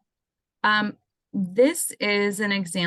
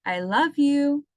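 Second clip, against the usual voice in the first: heartfelt recorded message; 8 semitones higher, 3 dB louder, slower than usual. Shown as a demonstration. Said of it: "So if I record".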